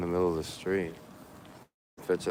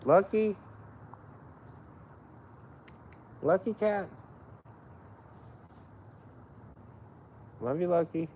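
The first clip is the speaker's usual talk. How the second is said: much higher sweet calling to the cat; 12 semitones higher, 5 dB quieter than usual. Real, not acted. Calling to his cat Lucky, high sweet voice. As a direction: coo to the cat.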